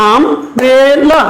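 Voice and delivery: Umbrella chopped into um, bre, la; a pause after each syllable of Umbrella, as if clapping it out. Shown as a demonstration. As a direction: say Umbrella very slowly in three separate beats. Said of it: "ক্লেপ দিয়ে সিলেবলটা বলো".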